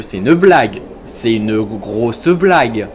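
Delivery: loud, emphatic exasperation; loudly protesting an unfair game; indignant. Real, not acted. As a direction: drag out the last phrase in disbelief. Protests at the game's result.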